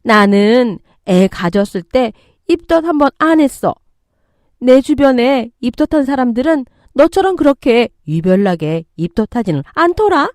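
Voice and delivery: scornful, irritated complaint; angry mother-in-law. Acted. Mother-in-law belittling daughter-in-law's morning sickness, angry. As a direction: belittle her with pointed stresses. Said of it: "시모가 왜 이렇게까지 화가 났는지".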